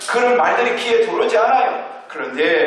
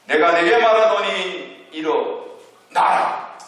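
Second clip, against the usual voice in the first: slow, raised commanding voice of Jesus; a solemn, slow command, pitched a little higher; 6 semitones higher, slower than usual. Acted. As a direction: call the dead youth to rise with slow authority. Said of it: "이렇게 명령합니다".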